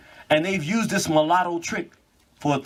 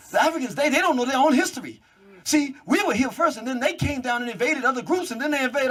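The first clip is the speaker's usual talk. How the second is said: her lecture voiced higher with mock certainty; mocking the host; 8 semitones higher than usual. Imitating the woman explaining African history to him, higher mocking tone. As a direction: ham up her know-it-all tone, pitched up. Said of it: "she's actually telling me".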